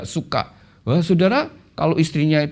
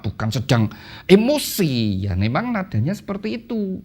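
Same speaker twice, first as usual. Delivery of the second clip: normal talking voice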